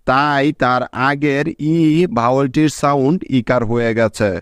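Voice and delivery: ordinary delivery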